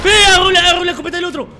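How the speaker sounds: fast, high, loud outburst; outraged shout. Real, not acted. Streamer seeing someone grab the other shotgun, shouting in outrage. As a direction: yell it in a rapid high burst.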